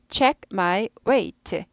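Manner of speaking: Check를 체크가 아니라 짧게 check; check, not 체크. Shown as a demonstration. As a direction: Check said as one short English check, not Korean 체크. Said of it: "체크 안 한다 그랬어요". (체크 말고 check로 발음)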